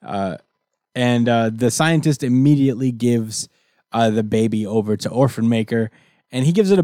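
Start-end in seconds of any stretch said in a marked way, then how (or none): none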